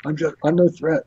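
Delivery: high, anxious acted plea; pleading surrender. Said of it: "He's like".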